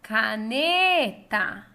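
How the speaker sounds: "Caneta" called out, NE loud and drawn out; calling out the word. Shown as a demonstration. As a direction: call "Caneta" like summoning someone, stretching the NE. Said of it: "vamos chamar essa palavra".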